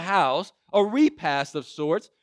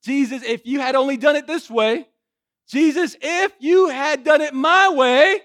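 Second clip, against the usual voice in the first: louder, higher whining demand; complaining believer; 7 semitones higher, 8 dB louder than usual. Retold or imitated. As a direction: believers grumbling at Jesus to do it their way. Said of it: "how many times do we say".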